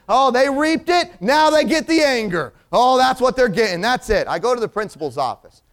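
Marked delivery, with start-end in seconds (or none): 0.1-4.3 higher, louder, self-righteous anger; retold anger. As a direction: preach your anger like a verdict. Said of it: "in my anger".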